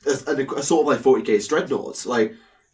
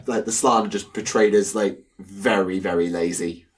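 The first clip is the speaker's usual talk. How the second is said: usual speaking voice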